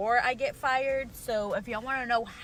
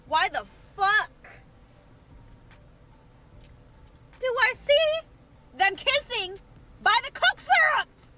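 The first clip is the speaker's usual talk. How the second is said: high, loud disbelief; outraged shock at the sight; 11 semitones higher, 4 dB louder than usual. Retold or imitated. Shocked at catching them kissing.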